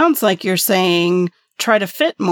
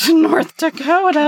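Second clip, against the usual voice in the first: surprised; 8 semitones higher than usual. Real, not acted. Incredulous at silly example.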